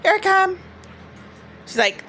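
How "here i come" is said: squeaky voice for the dog; lightly voiced pet talking; high mock dog voice. Imitating the dog's voice.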